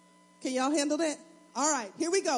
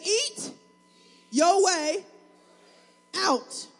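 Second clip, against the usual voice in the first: shout; 5 semitones higher, 5 dB louder, much slower than usual. Shown as a demonstration. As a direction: call it out loud, word by word. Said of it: "I want you to shout this".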